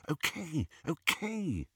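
soft, high, hurried-to-placate okay; hushed agreement. A narrator's reading. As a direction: give in under your breath. Hermione answers Harry's whispered scolding.

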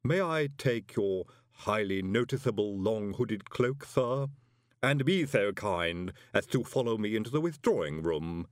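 unhurried, formal put-on servant voice; stately, deadpan creepy butler; Igor manservant. A narrator's reading. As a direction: usher the guest in with dry courtesy. Igor servant receives the guest.

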